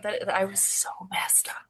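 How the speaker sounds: breathless hushed confession; whisper. Real, not acted. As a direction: confide it under your breath. Confessing the bad high.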